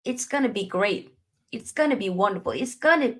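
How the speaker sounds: normal talking voice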